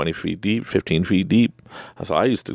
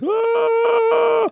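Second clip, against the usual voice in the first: long, high, loud mock scream; panicked scream; 5 dB louder than usual. Body's answer to calming words, acted panicked scream. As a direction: let out a sustained high shriek in imitation. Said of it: "My, my body, mind's like".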